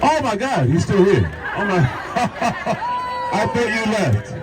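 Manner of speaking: high, delighted surprise breaking into a laugh; a happy gasp of surprise, then a giggle; surprised. Real, not acted. Spots David Morse still in crowd.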